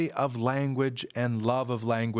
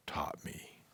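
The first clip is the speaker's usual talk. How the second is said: soft, unvoiced wise tree voice; tree whispering a question to the boy; 7 semitones lower, 13 dB quieter than usual. Tree replies.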